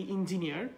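"ইঞ্জিনিয়ার" as in-ji-ni-ar; in- start, sounded r in "ইঞ্জিনিয়ার"; common mispronunciation. Shown as a demonstration. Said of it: "আমরা অনেকেই বলি".

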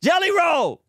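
a high, loud protest of the name; loud call-out. Real, not acted. Host calling out his guest [name], a high loud protest. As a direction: shout his name to rein him in.

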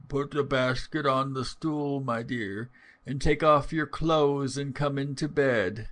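a hint of feeble old grandmother; feigned feeble voice. A narrator's reading. Wolf posing as grandmother in bed, mildly feeble voice. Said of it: "trying all he could to speak in a feeble voice".

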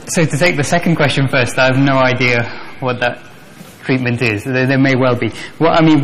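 normal talking voice

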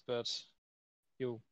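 normal talking voice

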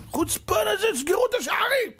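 very high urgent command; outraged order. Retold or imitated. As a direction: angels slamming the gates shut. Angels shutting heaven's gates. (פקודה נזעמת וגבוהה מאוד)

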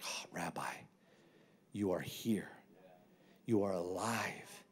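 soft, breathy exclamation of awe; mild, hushed wonder at seeing him alive. Acted. Disciples' awed reaction to risen Jesus.

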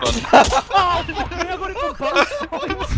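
high laughing curse; laughing exclamation. Real, not acted. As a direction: laugh through the words. Shot from both sides, laughing.

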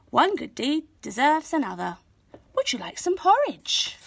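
put-on gentle old woman offering the girl porridge; kindly old woman voiced. A narrator's reading.

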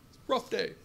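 normal talking voice

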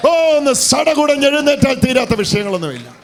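high shouted climax; full-force preaching. Acted. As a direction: build to a loud, soaring shout.

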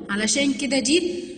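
ordinary talk, nothing marked

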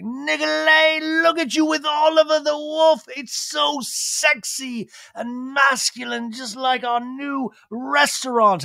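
very high, drawn-out put-on voice; gushing British restaurateur impression. Lisa admiring her design partner with the wolf, gushing impression. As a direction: coo slowly in mock admiration. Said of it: "you know, just like".

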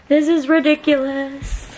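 ordinary talk, nothing marked

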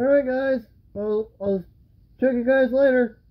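muffled behind full face mask; speaking through respirator voicemitter; through a gas mask. Real, not acted. Seen 5: Gas mask worn over face throughout; R frames show it held only.

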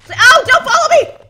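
high, loud panicked yell. Real, not acted. Fleeing a strong enemy.

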